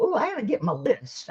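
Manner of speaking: usual speaking voice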